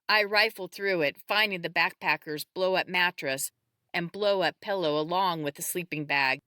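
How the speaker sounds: ordinary delivery, nothing marked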